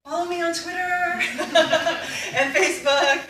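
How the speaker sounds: high, loud sing-out broken by laughter; jokey plug. Real, not acted. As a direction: plug yourself playfully, laughing at it. Jokey self-promotion at talk's close.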